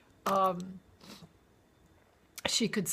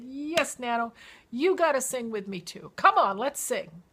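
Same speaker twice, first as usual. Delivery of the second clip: eager urging; 5 semitones higher, 7 dB louder than usual. Retold or imitated. Urging grandma again.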